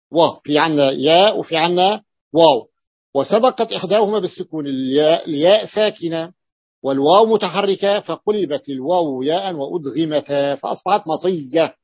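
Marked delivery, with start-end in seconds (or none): none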